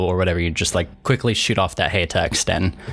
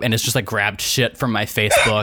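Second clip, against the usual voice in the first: ordinary talk, nothing marked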